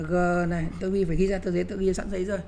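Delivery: usual speaking voice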